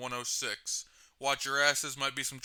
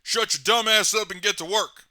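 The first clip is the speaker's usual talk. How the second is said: higher, louder furious order; angry yell; 6 semitones higher, 9 dB louder than usual. Acted. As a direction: bark him back to work. Boss snaps at worker.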